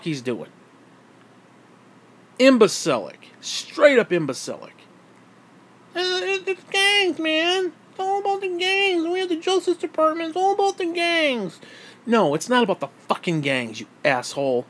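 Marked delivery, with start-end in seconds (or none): 6.0-11.6 very high, dopey mock voice; squeaky high mockery of an official; mocking Jeff Sessions. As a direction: mimic a clueless official droning on.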